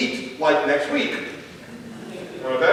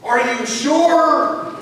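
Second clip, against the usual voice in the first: put-on higher, very slow kid voice; doubtful child; 5 semitones higher, much slower than usual. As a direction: draw out the doubt like a skeptical kid. Son doubts title.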